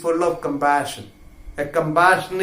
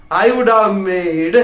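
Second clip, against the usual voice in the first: normal talking voice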